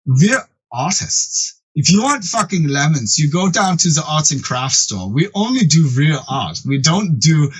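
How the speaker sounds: slow, snooty offended artists; all attitude. Retold or imitated. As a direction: imitate haughty artists, drawing the words out. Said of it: "like, all attitude".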